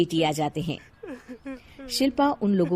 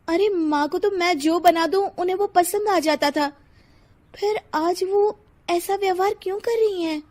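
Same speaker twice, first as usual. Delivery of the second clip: higher-pitched, hurt puzzlement; bewildered Shilpa; 10 semitones higher than usual. Acted. Daughter-in-law wondering why mother behaves so, higher and bewildered. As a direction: wonder aloud, baffled by her behaviour.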